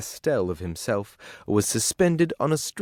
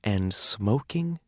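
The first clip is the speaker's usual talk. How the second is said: disdainful; 4 dB quieter, much slower than usual. A narrator's reading. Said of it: "She gave a sniff".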